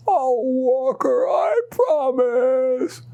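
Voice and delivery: put-on high, slow, tearful child's promise; sobbing plea. Daughter promises her father through tears, sobbing. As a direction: cry it out, drawn out and begging. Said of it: "while Emily sobbed".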